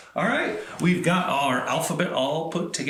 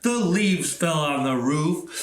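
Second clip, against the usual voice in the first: ordinary delivery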